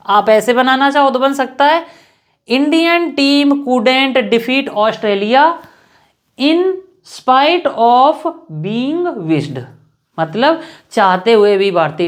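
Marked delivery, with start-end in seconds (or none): 2.5-9.6 very slow, word by word, spacing each phrase; slow dictation. Shown as a demonstration. Teacher dictating an example sentence to students, very slow. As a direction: spell it out slowly for students to copy. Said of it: "dictating the example slowly".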